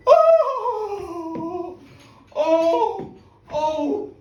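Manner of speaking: put-on boy's breathless joy at the top of the hill; awestruck excitement. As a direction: gasp at the view like a thrilled kid. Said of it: "he was so excited".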